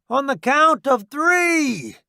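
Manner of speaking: high slow loud call; calling the count. Acted. Soldier giving the count.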